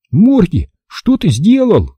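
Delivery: high, slowed exclamation of reproach; dismayed scolding. A narrator's reading. Boy confronts dog over eaten dough, dismayed scolding. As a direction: cry it out high, dragging the question.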